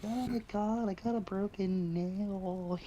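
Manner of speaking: high, slow prissy whine; hammed-up high slow whine; mocking whiny server. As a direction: ham up a spoiled complainer.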